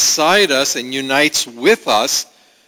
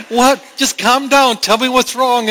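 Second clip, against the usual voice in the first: pleading mom; 10 semitones higher, 3 dB louder than usual. Retold or imitated. Mother pleading with crying toddler.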